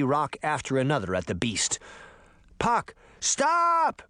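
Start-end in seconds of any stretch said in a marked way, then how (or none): 2.6-4.0 very high, stretched plea; shouting. A narrator's reading. Sabrina halting Puck's rock throwing, shouting. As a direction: call out urgently, drawing out stop. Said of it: "Sabrina shouted".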